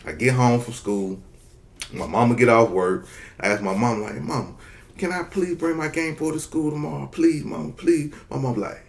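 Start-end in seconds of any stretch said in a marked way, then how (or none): 4.2-8.1 put-on high, soft pleading little boy; begging kid. Boy begs his mom to bring the Game Boy, soft high pleading. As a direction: plead softly and high like a child.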